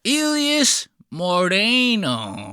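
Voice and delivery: slow, high, drawn-out mock Spanish accent. Editor mocking how host said his name, exaggerated Spanish accent. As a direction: mimic an overdone Spanish pronunciation.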